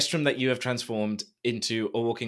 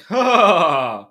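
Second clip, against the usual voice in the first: loud, higher smug "Ha!"; mock smug gloat; 6 semitones higher, 12 dB louder than usual. Host teasing guest about gloating while sober, loud and smug. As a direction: crow from the high horse. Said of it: "be like".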